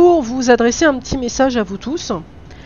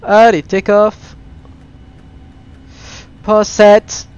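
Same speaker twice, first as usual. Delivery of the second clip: excited; 6 dB louder, slower than usual. Real, not acted. Streamer starting the takeoff roll.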